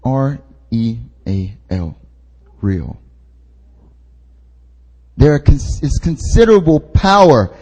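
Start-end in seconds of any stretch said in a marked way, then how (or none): 0.1-3.0 R E A L slow and soft, letter by letter, then a low "Real"; spelled-out emphasis. Real, not acted. Preacher driving home "Real" to congregation, R E A L spelled slowly and softly. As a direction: spell out R E A L slowly, pitch dropped, then land quietly on "Real".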